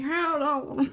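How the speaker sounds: put-on playful old-timer with a southern accent; southern old man. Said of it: "a little southern old man accent".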